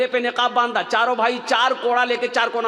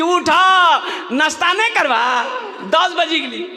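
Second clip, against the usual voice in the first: calling out to wake; 6 semitones higher than usual. Acted. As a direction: shout him out of bed. Wife waking husband late.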